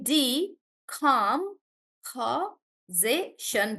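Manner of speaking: "D, com, pa, ze, shun" in separate beats; syllable by syllable. Shown as a demonstration. Teacher breaking a word up for students, syllable by syllable. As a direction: sound out "D, com, pa, ze, shun" slowly with gaps. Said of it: "They also say the word out loud".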